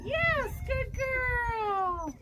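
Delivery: slow, high, sing-song praise for the dog; drawn-out praise. Real, not acted. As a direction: stretch out the praise to reward her.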